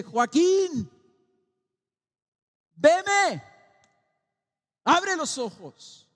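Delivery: voz alta y aguda, "abre los ojos" más lento; llámalo con fuerza para que despierte; llamado fuerte. Acted. Christ calls the blind man. (Cristo llama a Joaquín para que abra los ojos, en voz alta)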